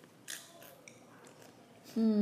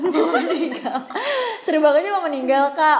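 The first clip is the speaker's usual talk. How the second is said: laughing; 7 semitones higher, 8 dB louder than usual. Real, not acted. Echoing a friend's joke, laughing.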